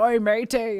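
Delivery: mock pirate call; pirate talk. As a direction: imitate a hearty pirate hail. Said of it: "they were talking like pirates".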